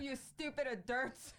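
joke-scold with "adults" said as "a-durts"; goofy mock insult. Joining in on the pronunciation joke.

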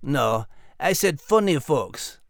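Scottish dog voice. Acted. Max voice held.

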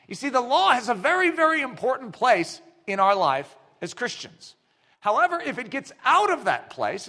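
ordinary delivery

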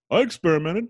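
high-pitched Brooklyn confession; Brooklyn detective dad. Retold or imitated. Brooklyn dad confesses to his son, high put-on voice. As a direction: imitate a Brooklyn father owning up. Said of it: "he talks like a detective from Brooklyn".

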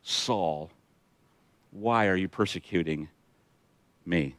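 low, soft, grave reading of a divine call; slow, hushed address with weighted pauses; understated voice from heaven, pitched low; lightly voiced heavenly voice. A narrator's reading. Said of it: "heard a voice saying to him".

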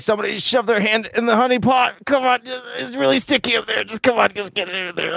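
high, pleading put-on voice; Winnie the Pooh. Bent-over bear begging for help with honey pot, high pleading voice. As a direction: beg in a high cartoon bear voice. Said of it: "He's like".

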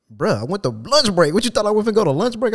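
high, incredulous comeback; indignant retort. Retold or imitated. Worker answers boss about lunch break, retold indignant retort. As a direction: argue back in disbelief, pitch climbing.

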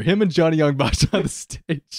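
normal talking voice

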